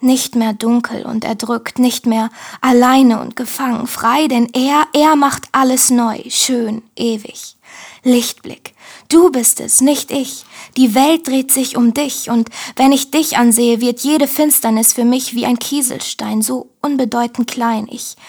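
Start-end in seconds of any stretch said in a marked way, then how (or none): none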